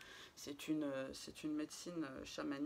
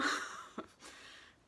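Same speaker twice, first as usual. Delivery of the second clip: laughing; 4 dB louder than usual. Real, not acted. Laughs at her morning face.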